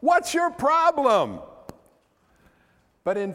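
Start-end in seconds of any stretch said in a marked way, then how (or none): 0.0-1.4 high, drawn-out heckle at Peter; mock jeering. Congregation mentally booing Peter at the verse, mock jeering. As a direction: boo him with long, swooping scolding words. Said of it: "not supposed to boo and hiss".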